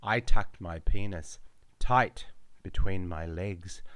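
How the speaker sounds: plain reading voice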